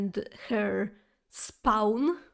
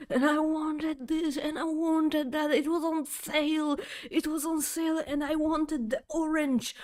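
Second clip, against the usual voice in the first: higher, petulant whine; whiny complaint; 5 semitones higher than usual. Retold or imitated. Complaining about her lost sale gel, whiny. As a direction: sulk like a cheated child.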